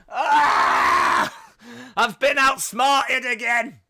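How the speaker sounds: loud, high, slow cartoon-villain wail; mock defeated villain cry; hammed-up villain defeat. WWE outsmarted, cartoon villain.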